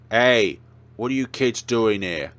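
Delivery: loud angry guard yell. Acted. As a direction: bark at the kids. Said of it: "the security guy from reception yells at us".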